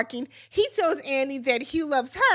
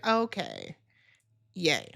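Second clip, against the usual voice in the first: low, flat mock "yay"; sarcastic lukewarm celebration; sarcastic cheer; 9 semitones lower, 4 dB quieter, slower than usual. Host reacting to the proposal, dead-pan sarcastic. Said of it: "And I said".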